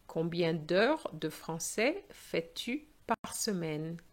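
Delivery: slow, careful repeat for learners; slow second reading. Shown as a demonstration. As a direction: spell it out slowly for the class. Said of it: "second slow reading".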